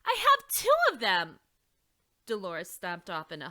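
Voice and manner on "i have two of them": very high, loud indignant shout. Acted. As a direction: yell it back proudly. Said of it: "Dolores shouted".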